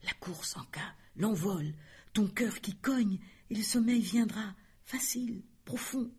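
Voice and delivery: chuchoté, qui s'éteint peu à peu; voix faible. A narrator's reading. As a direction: murmure intérieur qui faiblit jusqu'au souffle. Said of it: "Étrange cette voix ... si faible". (voix intérieure chuchotée qui s'efface)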